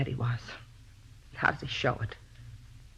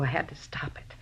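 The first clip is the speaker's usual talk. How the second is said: hushed confession; 3 semitones lower, 7 dB quieter than usual. Acted. Murderer confesses, defeated.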